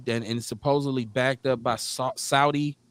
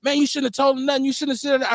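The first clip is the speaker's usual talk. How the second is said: higher, fast complaint from the coworker; annoyed scolding; 10 semitones higher, faster than usual. Retold or imitated. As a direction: hurriedly blame him for talking. Coworker scolding him for telling.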